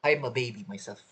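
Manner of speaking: normal talking voice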